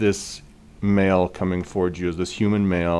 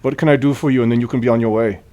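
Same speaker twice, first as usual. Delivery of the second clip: higher, louder rote brush-off; officious, in-character dismissal; oblivious priest; 4 semitones higher, 4 dB louder, faster than usual. Acted. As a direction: repeat a set speech, ignoring the threat. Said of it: "And he says".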